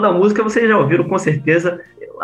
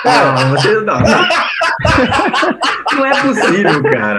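laughing; 3 semitones higher than usual. Real, not acted. Friends cracking up at lyric.

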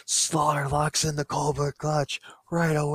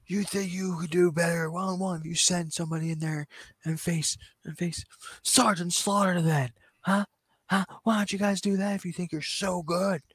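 higher-voiced taunting challenge, lightly voiced; mock taunt; 7 semitones higher than usual. Shawn Michaels challenging the outsiders, mock taunt. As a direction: goad them, a hint of Shawn Michaels.

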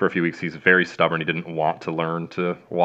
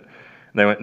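normal talking voice

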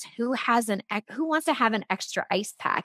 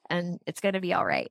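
ordinary talk, nothing marked

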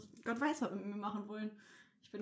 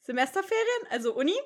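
much higher and louder, an exaggerated aha; mock surprised sweep; 10 semitones higher, 13 dB louder than usual. Teen imitates the sweep guessing at her age, high and loud mock surprise. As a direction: play the sweep's eager guessing, pitch jumping up. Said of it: "Der so".

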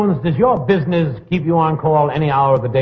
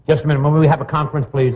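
usual speaking voice

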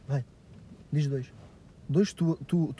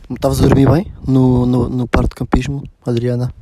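loud call; 2 semitones lower, 12 dB louder than usual. Real, not acted. Calling a sleepy friend.

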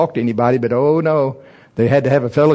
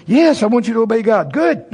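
mock lady voice; 9 semitones higher, 3 dB louder than usual. Lady's reply then his.

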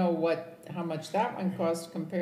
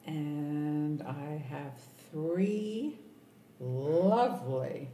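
slow and deliberate; much slower than usual. Real, not acted. Mom lists her family slowly.

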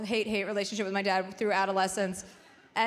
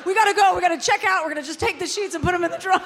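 mock frantic hurry; 7 semitones higher, 6 dB louder, faster than usual. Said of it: "he's like".

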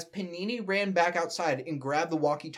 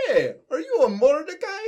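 high, loud cartoon plumber voice; Luigi; 10 semitones higher, 7 dB louder than usual. Asking Mordecai who he is, as a high-voiced Luigi. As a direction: pipe up high like an eager little plumber. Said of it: "Luigi asked".

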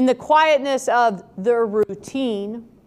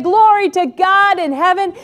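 heavenly praise; 7 semitones higher, 6 dB louder than usual. Acted.

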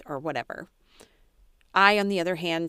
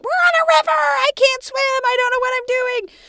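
very high, loud, frantic mock panic; panicked; 12 dB louder than usual. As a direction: shriek it like you're stuck on a river. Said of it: "And I'm like".

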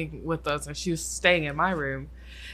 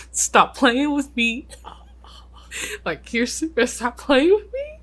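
higher, rising, half-laughing plea; nervous pleading; 7 semitones higher, 4 dB louder than usual. Retold or imitated. To her half sister asleep in the room, nervous high plea. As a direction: beg her to stop the joke, rising at the end. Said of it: "And I'm like".

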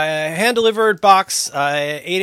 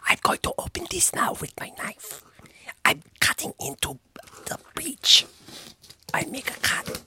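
soft whispered unboxing; 7 semitones higher, 10 dB quieter than usual. Pretend. Narrating knife unboxing for fake YouTube channel, whispered. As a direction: whisper it like an ASMR video. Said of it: "act like I'm [name]".

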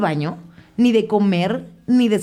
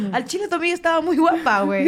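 high, giggly made-up confession; laughing tease; 10 semitones higher than usual. Pretend. Teasing her friend with a made-up confession, high laughing voice. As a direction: laugh while putting words in her mouth.

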